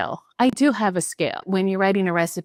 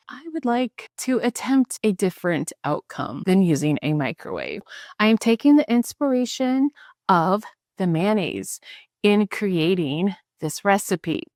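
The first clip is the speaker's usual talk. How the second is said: ordinary talk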